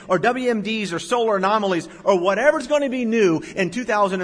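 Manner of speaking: high, loud pulpit delivery; full-force preaching. Acted. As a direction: drive it home from the pulpit.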